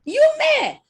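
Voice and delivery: high, loud, slow mock fury; mock anger. Describing the fired worker's rage with mock fury, high and loud. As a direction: play up his outrage.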